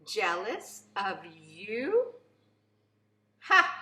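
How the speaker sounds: slow, disbelieving scoff then a loud burst; mock the very idea; drawn-out scornful question, sharp loud laugh. A narrator's reading. Mayor scoffs at accusation.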